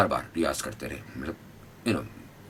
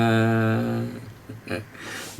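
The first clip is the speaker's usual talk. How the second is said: laugh; 5 semitones higher than usual. Real, not acted. Laughs after singing.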